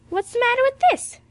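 high, loud demanding question. A narrator's reading. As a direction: pitch it way up and demand an answer. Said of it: "demanded Parry".

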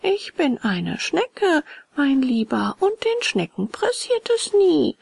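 high voice, very drawn-out words; unhurried, slow high snail voice; slow, leisurely snail. A narrator's reading. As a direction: stretch each phrase, in no hurry at all. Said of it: "So langsam und gemächlich wie zuvor sagte sie".

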